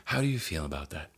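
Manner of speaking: hushed, lightly teasing shrink impression; mock psychiatrist. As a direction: gently parody a therapist. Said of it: "I'm not trying to make fun of psychiatrists".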